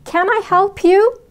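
"Can I help you?" slow and high, voice rising at the end on you; rising question tune. Shown as a demonstration. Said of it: "الصوت في الآخر مرتفع". (نغمة صاعدة في آخر السؤال)

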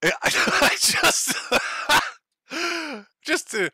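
breaking into high laughter; can barely get it out for laughing. Real, not acted. Laughing at the stalker picture.

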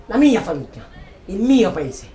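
high, loud burst of fury; furious shout at his brother; outraged cry. Acted. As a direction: explode at the word padre.

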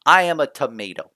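a game spelling everything out, hammed up; blunt announcement. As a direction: blurt the obvious answer out. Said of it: "doesn't just come out and say".

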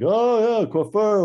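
imitating the guard's sudden "ah, I get it"; sudden recognition. Border guard suddenly getting the barber mime, high delighted. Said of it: "and they're like".

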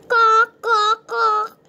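high, loud gawk gawk; squawky parrot noise; parrot squawk. Pretend. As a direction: honk it like a bird. Parrot pen speaking.

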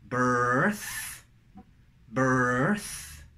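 long, rising "birth" with th; contrast drill. Shown as a demonstration. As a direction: stretch each "birth" upward into th. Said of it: "es muy útil el contraste". ("birth" alargado, con th final)

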